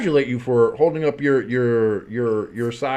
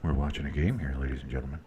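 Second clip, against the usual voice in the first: much lower, much softer, whispered play-by-play; football ASMR; 10 semitones lower, 11 dB quieter than usual. Shown as a demonstration. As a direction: whisper the game call like ASMR. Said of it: "football A S M R".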